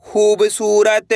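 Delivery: खूबसूरत stretched slowly with long ū and ā; drawn-out blend. Shown as a demonstration. Teacher blending syllables into खूबसूरत, slow and drawn-out. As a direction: draw out each part of खूबसूरत. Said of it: "तो मिलके बन जाएगा".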